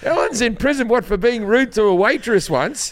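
high-pitched pretend disbelief; mock indignation. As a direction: feign indignant surprise at the news. Pretends to react to predicted news.

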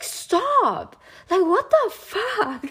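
high, drawn-out disbelief; half-laughing high-pitched "what the"; exasperated high retort; exasperated outburst. Retold or imitated. Retold exasperated reply to stalker.

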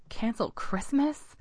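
can't believe what he heard; a mild gasp of disbelief; shocked disbelief. A narrator's reading. Adam shocked at the news.

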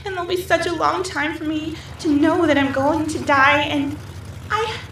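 rising fear. Acted. Woman in a dream airplane terrified of falling, panicking. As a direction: let the dread climb to a near wail.

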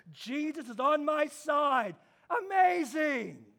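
imitated congregation crying out its approval; exultant crowd. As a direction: cheer the preacher on like a thrilled listener. Acted crowd rejoicing at message.

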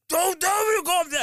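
hammed-up boss losing it; mock angry yell. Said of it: "And she'd be like".

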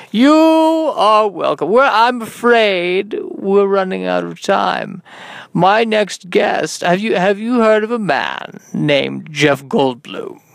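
slow, stretched-out hammy host delivery; mock talk-show host, starting high; over-the-top show host. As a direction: draw out each phrase like a showman.

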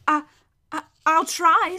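slow, unsure reply; hesitant boy. A narrator's reading. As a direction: stammer into a reluctant promise. Boy hesitantly answers the horse.

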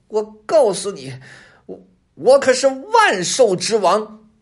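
high, loud proclamation, slowing down; pompous high-pitched boast; self-important fox. A narrator's reading. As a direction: announce yourself grandly in fox voice. Fox proclaims himself king of beasts.

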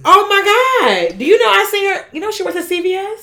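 high excited squeal. Real, not acted. Excited gossip about cousin.

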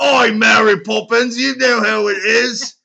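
high, loud, slow Cockney; rowdy hammed-up Cockney lad; Cockney accent. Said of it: "Slightly more believable than you".

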